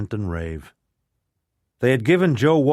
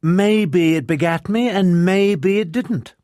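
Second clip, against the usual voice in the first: slow, cool and pointed, pitched higher; cool warning; 8 semitones higher, 5 dB louder, slower than usual. A narrator's reading. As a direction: drawl it out as a calm threat. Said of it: "Tasman warned him coolly".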